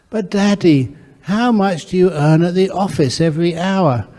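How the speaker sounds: put-on high, insistent little boy voice; small son nagging his dad about his pay; pestering child. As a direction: keep nagging like a six-year-old.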